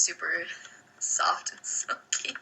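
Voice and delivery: slow, breathy shampoo-ad whisper; answering the shampoo question in a slow mock ad whisper. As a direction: sell it like a hair commercial, whispered.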